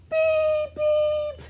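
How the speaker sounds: high robot beep; squeaky robot beeping. Acted. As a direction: chirp it like a little robot.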